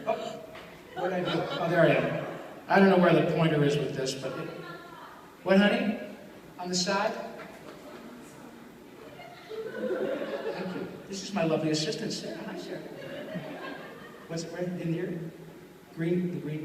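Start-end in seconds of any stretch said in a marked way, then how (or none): none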